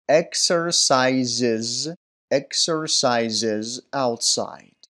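very slow "exercises", each syllable separate up to the final iz; pronunciation demo. Shown as a demonstration. Teacher modelling the iz ending, very slow "exercises". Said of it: "طریقهٔ تلفظ درستش رو".

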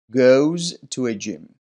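slow "goes", z ending sounded clearly; pronunciation demo. Shown as a demonstration. Teacher reading the answer aloud, slow careful "goes". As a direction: stretch "goes to a gym" out slowly for learners. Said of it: "طریقهٔ تلفظ درستش رو".

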